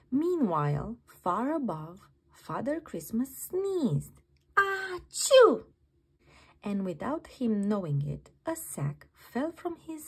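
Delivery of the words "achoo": loud, high sneeze; big sneeze. Acted. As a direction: let out a huge theatrical achoo. Father Christmas sneezes.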